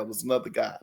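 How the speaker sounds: ordinary talk, nothing marked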